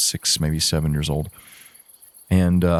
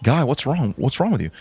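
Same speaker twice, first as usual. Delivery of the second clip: lightly acted alarm, pitched up; alarmed kids; 7 semitones higher than usual. Other kids to the freaked-out boy, mildly alarmed. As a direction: mimic baffled kids demanding an answer.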